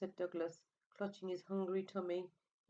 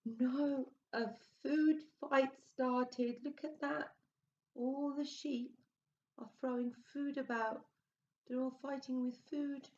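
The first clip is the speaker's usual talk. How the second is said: higher, louder excited telling; 8 semitones higher, 4 dB louder than usual. A narrator's reading. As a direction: gasp and get caught up in it. Teller excited at food fight.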